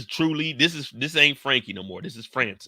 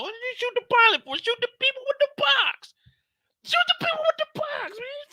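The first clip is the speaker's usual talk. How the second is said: much higher, loud outraged pilot voice; angry shot pilot; 5 dB louder than usual. Retold or imitated. Pilot yelling at the crew who shot him, high outraged yelling. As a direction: scream at your own crew for shooting you.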